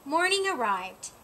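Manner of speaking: usual speaking voice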